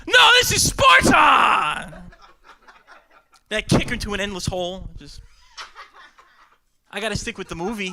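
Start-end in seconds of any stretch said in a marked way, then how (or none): 0.1-2.0 huge, high, drawn-out roar; full-force acted war cry; warrior battle cry. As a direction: bellow it slowly like a movie warrior. Bellowing the film's war cry.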